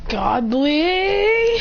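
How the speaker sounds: power-up call. Acted. Fighter powering up, rising high-pitched call. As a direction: let the word climb as power builds.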